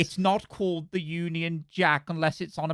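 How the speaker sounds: slow, fussy pedant voice; nerdy voice. Pretend. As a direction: lecture like a know-it-all. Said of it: "doing the nerdy voice".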